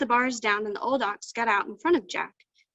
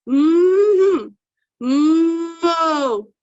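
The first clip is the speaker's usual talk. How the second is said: long, loud ox bellow; drawn-out, troubled mooing, pitched up and loud; 6 semitones higher, 11 dB louder than usual. A narrator's reading. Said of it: "a bellowing like it was troubled".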